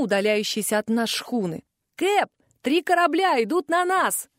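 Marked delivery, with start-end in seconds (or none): 2.0-4.3 high-pitched lookout's call; calling from above. A narrator's reading. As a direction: call a warning down to the captain. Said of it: "крикнул сверху Птиц".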